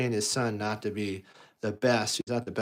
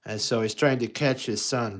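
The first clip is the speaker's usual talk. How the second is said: usual speaking voice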